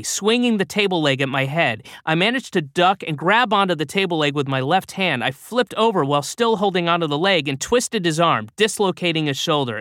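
ordinary delivery, nothing marked

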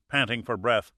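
ordinary delivery

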